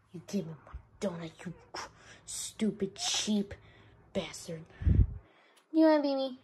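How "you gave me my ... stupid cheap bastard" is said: fast, unvoiced, sobbing whispered insult; tearful whisper. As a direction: hiss the insult through tears. Angry birthday boy insulting cheap cake.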